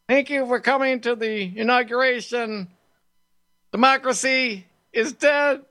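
lightly voiced politician at a podium; mock DeSantis speech. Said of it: "a soaring orator that is DeSantis".

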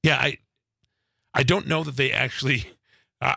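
usual speaking voice

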